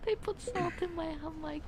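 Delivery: high, soft tearful whine; put-on sobby little wail; mock crying girl. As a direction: whimper the complaint in a small high voice. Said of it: "that's what you sound like, girl".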